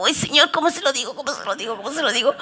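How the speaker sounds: acted dithering worry. Pastor asks God how to correct Rosita, acted fretting. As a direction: fret under your breath. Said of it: "yo digo".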